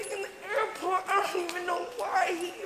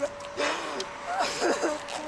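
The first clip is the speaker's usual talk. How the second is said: sob. Real, not acted.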